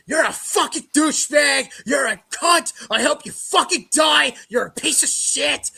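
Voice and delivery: mock-furious trolls, each insult hit hard; angry online abuse. Fan retelling hate comments from Twitter, angry yelling.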